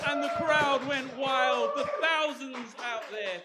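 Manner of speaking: loud, slow arena boom; mock arena announcer. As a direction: announce your own huge crowd. Mock crowd roar intro.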